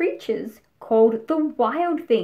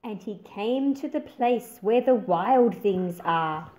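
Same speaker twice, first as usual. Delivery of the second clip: put-on expressive read-aloud for young listeners; storybook narration. A narrator's reading. Teacher reading the picture book aloud to her class, expressive narration. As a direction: paint the pages with a lively storytelling voice.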